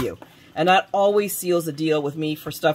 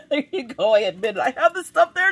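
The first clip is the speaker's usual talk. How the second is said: laughing; 6 semitones higher than usual. Real, not acted. Laughs at calling herself a coward.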